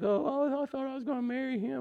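high, fast mock wail of a jilted teen; heartbroken daughter. Father imitating his daughter after a breakup, high and fast. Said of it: "she's like".

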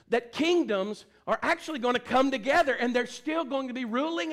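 loud, high, driving sermon delivery; fervent pulpit proclamation; preaching at full force. Real, not acted. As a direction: preach it hard, punching the key words.

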